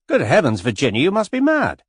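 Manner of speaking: be appalled and let it burst out; scandalised exclamation. Acted. George scolding Virginia, loud and horrified.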